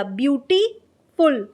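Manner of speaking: "Beautiful" split slowly in two, a pause before "फुल"; beautiful में pause. Shown as a demonstration. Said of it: "एक बार थोड़ा सा pause लिया". ("Beautiful" को "beauty" और "फुल" के बीच रुककर बोलें)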